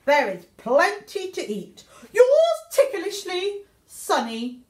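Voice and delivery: ordinary delivery, nothing marked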